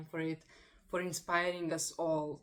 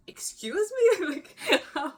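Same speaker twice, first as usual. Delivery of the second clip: much higher, louder, disbelieving excuse me breaking into a laugh; mock amazed; 13 semitones higher, 10 dB louder, slower than usual. Serbian friend learning Sienna's age, amazed, laughing in disbelief. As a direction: act out the friend's stunned disbelief, then laugh. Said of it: "and she was".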